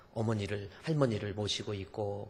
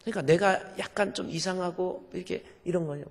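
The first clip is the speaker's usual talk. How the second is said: usual speaking voice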